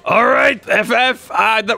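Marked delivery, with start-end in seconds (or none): none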